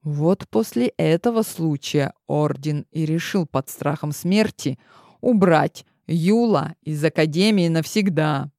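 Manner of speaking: ordinary delivery, nothing marked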